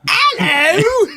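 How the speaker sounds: high, loud Cockney greeting; hammed-up high Cockney call; mock Cockney. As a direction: imitate a squawking Cockney hello. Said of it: "Benton showed up today speaking Cockney".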